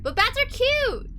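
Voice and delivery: high, drawn-out cute plea; gushing protest. Acted. Passenger defending bats to the boat operator, high gushing protest. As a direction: squeal it slowly in defense.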